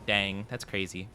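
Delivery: usual speaking voice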